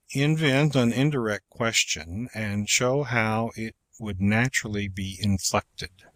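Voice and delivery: ordinary talk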